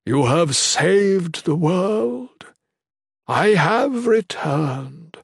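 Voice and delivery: slow, stately, drawn-out proclamation; reassuring divine being. A narrator's reading. God rewarding his victorious servant, slow kind proclamation. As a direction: bless them grandly, letting words swell. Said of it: "voice reassuring and kind".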